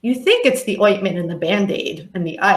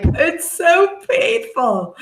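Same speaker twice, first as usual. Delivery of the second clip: whiny complaint; 10 semitones higher, slower than usual. Retold or imitated. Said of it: "those of you listening that are like".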